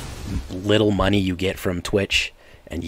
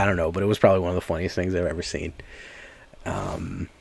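normal talking voice

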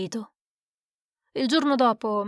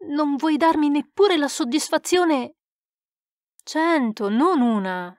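exasperated plea; 5 semitones higher, 3 dB louder than usual. Acted. Friend pleads in heated argument.

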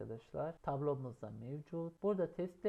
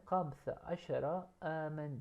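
slow Arabic model; slower than usual. Shown as a demonstration.

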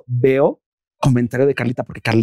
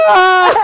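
high, loud mock scream; re-enacted scream; 13 semitones higher, 6 dB louder than usual. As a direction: scream in exaggerated horror at your own blunder. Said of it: "yo estaba gritando". (grito agudo, actuado entre risas)

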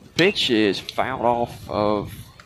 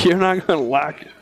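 laughing; 6 semitones higher than usual. Real, not acted. Laughs while teasing his partner.

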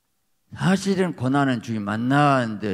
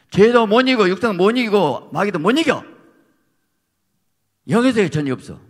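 much louder and higher, each clause hurled; shouted rebuke; 10 semitones higher, 10 dB louder than usual. Real, not acted. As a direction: rail against them from the pulpit. Preacher shouting rebuke of seminaries. (강단에서 호통치듯 크게)

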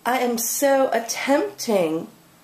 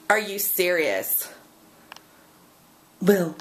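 ordinary delivery

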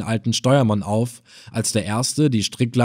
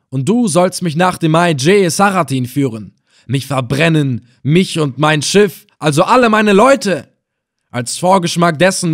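higher, loud, punishing commands; wrathful order; 5 semitones higher, 6 dB louder than usual. A narrator's reading. Reis Effendina ordering the bastinado, wrathful. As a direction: order the beating harshly.

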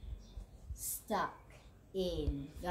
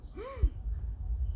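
high idea gasp; 13 semitones higher than usual. Acted. Frog gets an idea.

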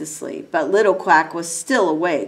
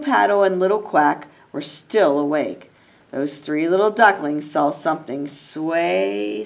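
usual speaking voice

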